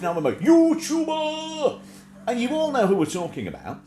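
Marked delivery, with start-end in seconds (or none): 0.0-1.8 pompous boast. Retold or imitated. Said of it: "boasting mock pompous".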